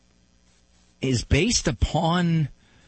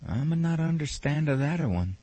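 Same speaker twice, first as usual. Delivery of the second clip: softer, broken-English Italian grandfather; Italian accent; 4 dB quieter than usual. Retold or imitated. Grandfather giving up on understanding, Italian accent. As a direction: mumble it like a puzzled old Italian. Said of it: "in his broken Italian accent".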